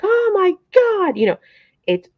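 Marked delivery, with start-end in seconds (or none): 0.0-1.2 high, slow exasperated oh my God; drawn-out mock frustration, pitched high; mock frustrated groan. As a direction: ham up the beginner's despair. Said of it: "don't get all frustrated and be like".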